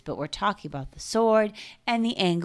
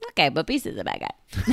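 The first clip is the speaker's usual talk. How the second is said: usual speaking voice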